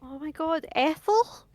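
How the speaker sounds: high, rising mock amazement; feigned surprise. Greets a supposed old acquaintance.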